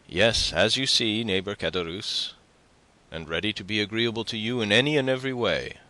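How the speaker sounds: civil words over ill-hidden coldness; coolly civil. A narrator's reading. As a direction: politeness as a thin cloak for coldness. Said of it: "ill concealing his coldness under this cloak of civility".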